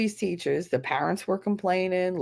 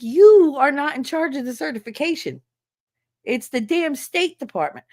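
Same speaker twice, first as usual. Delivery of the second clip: angry; 6 semitones higher, 5 dB louder than usual. Retold or imitated. Angrily retelling telling off his boss.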